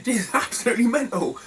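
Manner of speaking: high, giggly exclamation; cracking up mid-sentence; laughing. Real, not acted. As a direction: say it through real laughter. Laughing at his own story.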